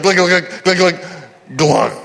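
glugging bursts, then a last clunk; imitated engine sputter. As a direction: mimic an engine dying without warning. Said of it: "it wasn't even, like, any warning".

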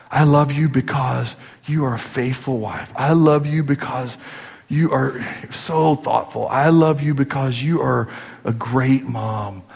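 mean every word to your wife; husband softly praising his wife; tender compliment. Shown as a demonstration. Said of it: "fill in the blank".

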